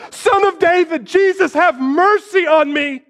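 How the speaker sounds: high, straining cry for mercy. Acted. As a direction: scream it over the crowd. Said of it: "makes him scream louder".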